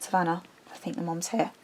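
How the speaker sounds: hushed warning. Retold or imitated. Warning Savannah the mom is here, hushed and quick. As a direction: murmur it quickly so the mom won't hear.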